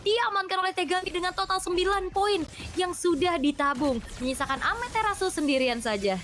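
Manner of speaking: high, fast hype play-by-play; fighting game announcer. Acted. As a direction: call the triple kill at full excitement. Live play-by-play hype moment.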